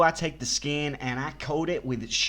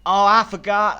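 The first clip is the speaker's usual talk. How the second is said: surprised; 7 semitones higher, 10 dB louder than usual. Acted. Prank caller feigns sudden recall.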